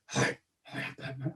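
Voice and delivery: hushed, whispered superhero voice; Batman. Retold or imitated. Cosplayer correcting himself to a kid, whispered superhero impression. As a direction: drop to a mock-ominous whisper. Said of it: "Dude, you're Batman".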